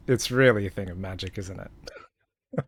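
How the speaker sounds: ordinary delivery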